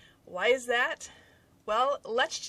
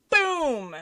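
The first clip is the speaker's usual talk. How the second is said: sudden loud higher-pitched BOOM; 7 semitones higher, 9 dB louder than usual. Retold or imitated. Telling viewers of the exploding egg, loud bang. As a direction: boom it out like a blast.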